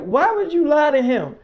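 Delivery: imitated incredulous demand; mock confrontation. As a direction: challenge them, acting out a telling-off. Acted mother confronting daughter.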